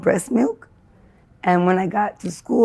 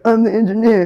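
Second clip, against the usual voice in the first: announcing shout; 4 semitones higher, 6 dB louder than usual. Retold or imitated. Acted quote announcing herself.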